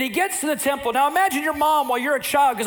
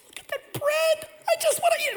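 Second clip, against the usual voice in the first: put-on excited little boy. Boy Samuel eyeing the temple bread, excited child voice. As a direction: squeal it like a hungry kid.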